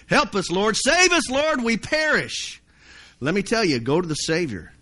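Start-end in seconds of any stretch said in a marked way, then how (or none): 0.1-2.6 high-pitched cry for help; desperate plea. Acted. Sinking believer finally calls on the Lord, desperate cry. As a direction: plead urgently, voice leaping up high.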